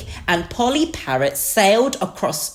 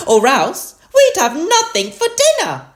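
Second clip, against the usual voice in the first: high, loud mother sheep voice; Mummy Sheep; 6 dB louder than usual. A narrator's reading. Mummy Sheep worries over dinner, high and loud. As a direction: carry on in a raised pitch. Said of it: "said Mummy Sheep".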